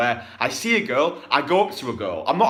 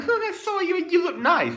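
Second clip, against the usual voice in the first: much higher, laughing mock of a timid guy; mock weak guy. Coach mocking a timid approach, high and laughing. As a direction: squeak it like a nervous beta.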